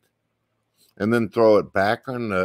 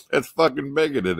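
normal talking voice

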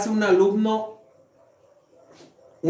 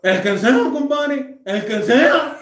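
nasal gangoso punchline, higher; 6 semitones higher, 3 dB louder, slower than usual. Retold or imitated. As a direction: squeeze it through the nose, laughing. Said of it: "el compadre de afuera le dice".

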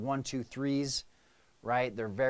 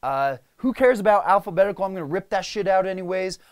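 louder, higher, pushy; impatient command voice, raised; warrior mode; 6 semitones higher, 8 dB louder than usual. Retold or imitated. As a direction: bulldoze everyone to get moving. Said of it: "So I'm like".

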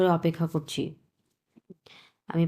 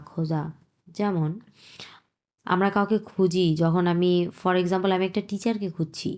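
ordinary talk, nothing marked

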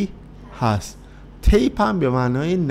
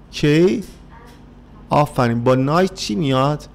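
ordinary delivery

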